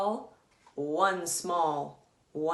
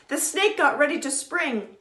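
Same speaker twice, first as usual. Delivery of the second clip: usual speaking voice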